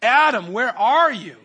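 loud, high call across a garden. Retold or imitated. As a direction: call out searching for someone hidden. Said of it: "God is the one who comes out and cries".

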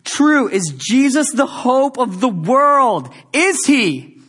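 loud, high preaching cry; preaching at full force. Acted. Pastor preaching hard to the church about Jesus, full force. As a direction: thunder it out to the congregation.

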